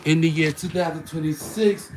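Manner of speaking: high, loud and fast; excited. Real, not acted. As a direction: hype up the viewers. Excited address to viewers.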